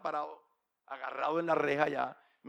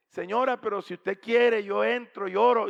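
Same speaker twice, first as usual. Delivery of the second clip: loud, higher pleading offer; insistent offer; 6 semitones higher, 10 dB louder than usual. Retold or imitated. Stranger offering the wife a prayer, insistent. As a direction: press the offer with raised voice.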